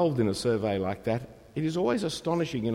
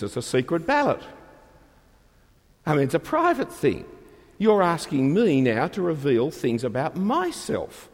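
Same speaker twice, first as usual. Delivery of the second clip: ordinary talk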